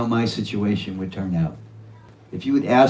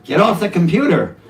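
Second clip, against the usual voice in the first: higher, louder mock scold; mock scolding parent; 8 semitones higher, 6 dB louder than usual. Parent telling teenage son to get off computer, mock scold. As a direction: snap at the teen as a fed-up parent.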